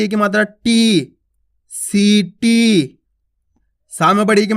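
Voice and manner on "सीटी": सीटी with the vowels held long; drawn out. Shown as a demonstration. Teacher drawing the word out for children. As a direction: stretch सीटी very slowly. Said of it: "खींच खींच के उच्चारण करना है".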